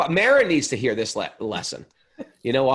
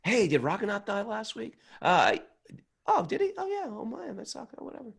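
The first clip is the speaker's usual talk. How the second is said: offhand gossip; 9 semitones higher, 5 dB quieter than usual. Retold or imitated. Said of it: "back to work".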